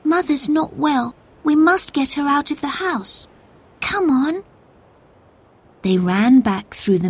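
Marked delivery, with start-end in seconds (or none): 0.0-4.4 put-on high-pitched, worried girl; scared. A narrator's reading. Molly urging her friends to get Mother out, scared. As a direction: voice a frightened child urging the others, pitched up. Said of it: "Molly looked scared".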